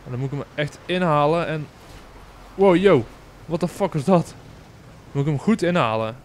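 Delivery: usual speaking voice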